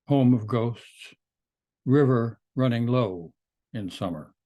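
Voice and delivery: measured poem recital with pauses between phrases; poetry reading. Acted. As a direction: read your poem to an audience, phrase by phrase. Reads the title poem aloud.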